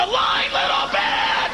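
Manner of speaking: polite start rising to a high scream; employer's scream. Retold or imitated. Employer turning down the girlfriend, polite then screaming. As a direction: begin politely, end screaming.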